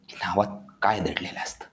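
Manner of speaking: hushed whisper, breath only. Acted. To the audience, a hushed whisper revealing the secret. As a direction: lean in and whisper it. Dramatic reveal about names.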